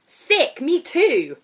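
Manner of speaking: put-on high, loud, brassy holiday girl; brash loud woman. Airport stranger hailing the narrator, high and loud. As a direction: belt it out like a brash party girl.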